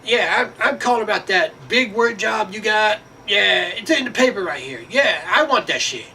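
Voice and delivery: high, hammed-up voice of a job caller; mock caller voice. Man calling about a big word job, high mocking voice. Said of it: "So they would call and say".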